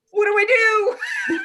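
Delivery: high, loud, laughing cry; panicked actor. Retold or imitated. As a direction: wail it in helpless panic. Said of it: "he was just like".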